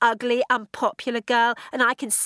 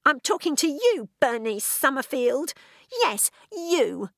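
put-on high, spiteful teenage sneer; furious hiss; 7 semitones higher, 3 dB louder than usual. A narrator's reading. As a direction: sneer at her clothes and hair. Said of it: "The voice was a furious hiss".

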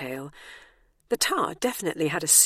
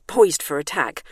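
plain reading voice

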